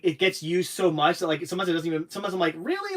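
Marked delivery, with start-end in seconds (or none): 2.6-2.9 incredulous. Retold or imitated. Retold incredulous reaction to slang.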